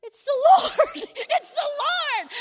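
high, loud, laughing cry; ecstatic shout. Retold or imitated. Group sees a light coming up the hill, shouting high and loud. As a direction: shout it like you've seen an angel. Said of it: "we're going".

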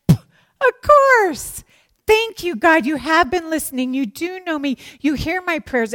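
loud, high burst of grateful amazement; overjoyed, acted-out relief; elated realization. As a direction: cry out thanks as it dawns on you. Acts out elated answer to God.

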